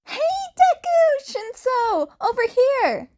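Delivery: put-on high, loud girlish call across the mall; Uraraka calling out. A narrator's reading. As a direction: call out to friends, high and bright. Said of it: "Uraraka calls out".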